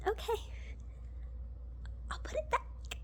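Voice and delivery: tiny, high, barely voiced surrender; sheepish little voice, backing down; meek resignation. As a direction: shrink into a small, defeated near-whisper. Acting sheepish retreat at checkout.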